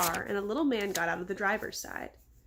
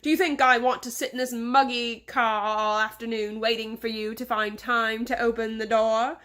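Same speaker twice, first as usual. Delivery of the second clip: put-on louder, scolding English-accented boss; bellowing English accent; 4 dB louder than usual. Boss berates driver over the muggy car, bellowing English accent. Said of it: "bellowed in an English accent".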